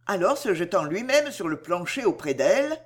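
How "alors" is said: plain reading voice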